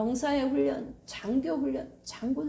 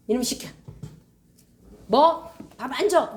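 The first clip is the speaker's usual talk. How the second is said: scolding mother; 2 semitones higher, 8 dB louder than usual. Retold or imitated. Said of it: "이런 엄마 누가 있어요".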